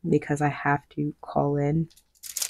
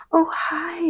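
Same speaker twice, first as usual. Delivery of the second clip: high sing-song hi; bright greeting; 13 semitones higher than usual. Retold or imitated. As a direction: greet in a much higher, delighted voice. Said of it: "they're like".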